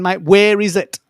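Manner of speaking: retelling his frustration at the late file; exasperated. Retold or imitated. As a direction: vent at a mate who won't deliver. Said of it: "And I'm thinking".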